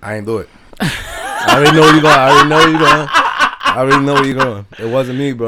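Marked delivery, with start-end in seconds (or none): 0.6-4.7 laughing, loud, slowing down; cracking up before the story. Real, not acted.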